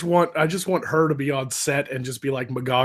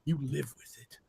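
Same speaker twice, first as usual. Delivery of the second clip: much softer, cold dismissal; cold sniper verdict, softly; cold low line; 3 semitones lower, 14 dB quieter than usual. Retold or imitated. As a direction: drop it quietly, deadpan. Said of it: "the dude stands up and he's like".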